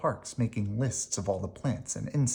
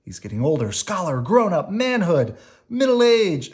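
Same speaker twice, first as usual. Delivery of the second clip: rising higher and louder word by word; building excitement; 5 semitones higher, 7 dB louder than usual. A narrator's reading. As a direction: climb with each word as he ages. Reading a building list of synonyms.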